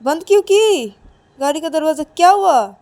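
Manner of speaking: high, loud bewildered question; can't believe she shut the door; surprised pleading. Acted. Ananya asks Payal, bewildered, why she shut the car door. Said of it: "तो तभी अनन्या बोलती कि".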